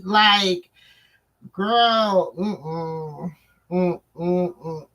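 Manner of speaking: ordinary talk, nothing marked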